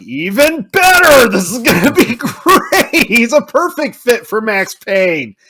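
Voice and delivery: giddy fan cheering the casting news; overjoyed. Retold or imitated. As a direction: squeal with delighted laughter. Retold overjoyed reaction.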